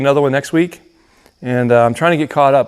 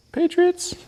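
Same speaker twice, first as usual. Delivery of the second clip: high cheering shout of the team name; sports fan cheer; 4 dB quieter than usual. Acted. New England fan cheer.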